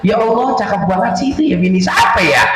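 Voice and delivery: high, quick gossipy chatter; gossiping neighbor. Retold or imitated. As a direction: gush like a nosy neighbor. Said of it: "Kan ada aja orang ngomong".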